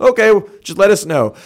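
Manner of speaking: the overly agreeable Canadian, hammed up; mock meek Canadian. Acting out Canadians replying.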